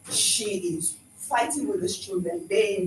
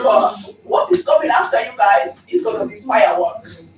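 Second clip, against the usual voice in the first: warning; 7 dB louder than usual. Retold or imitated. Retold warning.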